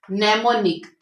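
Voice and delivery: mnemonic begun straight on the n; silent m. Shown as a demonstration. Teacher models the silent m for students. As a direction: start mnemonic with no m sound. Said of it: "ये start में जो है ना ये silent है".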